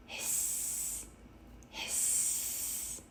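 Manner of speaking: soft steamy hiss, no voice; kettle hiss. A narrator's reading. Tea kettle whistling, a soft breathy hiss. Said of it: "The tea kettle whistled".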